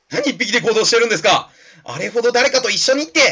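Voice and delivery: high, loud scolding little creature; Dedenne. Acted. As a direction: scold the boss, climbing to a squeal. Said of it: "ちょっとデデンヌっぽい声、 声でいくわ。". (甲高い声で団長を叱る小さな部下)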